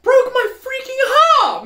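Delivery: very high, loud, drawn-out cry; hammed-up tearful shout; heartbroken wail. Heartbroken reaction to the video.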